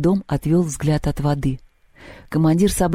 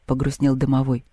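plain reading voice

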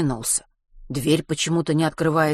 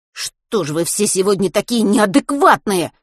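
exasperated, raised-voice question; heated exclamation; 4 semitones higher, 5 dB louder than usual. A narrator's reading. Boy exclaiming at unreasonable adults, exasperated. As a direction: burst out in frustration at everyone. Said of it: "воскликнул он в сердцах".

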